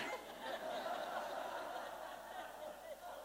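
breathy high laugh; soft airy laugh. Real, not acted. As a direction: let out a laugh at the joke. Laughs at own joke.

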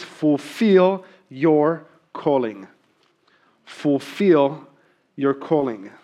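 slow, deliberate, each word weighted; emphatic big idea. Shown as a demonstration. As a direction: drive the big idea home slowly, with pauses. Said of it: "idea is this".

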